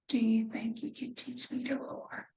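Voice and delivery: whispered, hushed and timid. Acted. Mouse whispers timidly to the lion, asking for roaring lessons. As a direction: breathe the question like a shy mouse.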